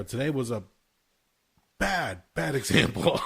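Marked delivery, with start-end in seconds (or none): 1.8-3.3 laughing through it, slowed down; amused, drawn-out admission. Real, not acted. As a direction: chuckle as you admit it. Laughing at own bad example.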